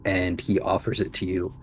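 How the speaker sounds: ordinary talk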